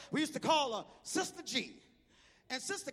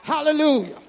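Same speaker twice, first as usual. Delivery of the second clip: loud, slow, high preacher shout; preaching at full force; 5 semitones higher, 15 dB louder, slower than usual. Acted. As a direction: stretch every word, raise the whole church. Preacher builds to climax with music.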